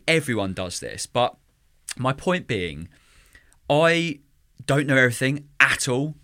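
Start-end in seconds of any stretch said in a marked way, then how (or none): none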